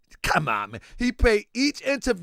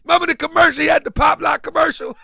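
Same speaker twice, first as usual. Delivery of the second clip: excited shouting; 8 semitones higher, 8 dB louder than usual. Real, not acted. Hyped recollection shouting.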